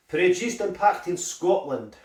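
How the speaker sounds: hammed-up, jokey Scottish accent; shit Scottish accent. Food reviewer reading the packet for a laugh, bad Scottish accent. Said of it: "my shit Scottish accent".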